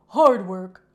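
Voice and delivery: loud punchy retort; cheeky. Acted. Coco answering Kate cheekily, loud punchy retort. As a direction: fire it back cheekily.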